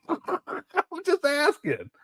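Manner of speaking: slow, laughing through the words. Real, not acted. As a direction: laugh it out innocently. Laughing at his own roast.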